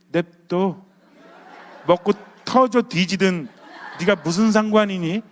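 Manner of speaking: slow, emphatic mock comeback; defiant retort. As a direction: act out a defiant mind-your-own-business retort. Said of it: "라는 생각하지 않아요". (반항하듯 힘주어 쏘아붙이는 흉내)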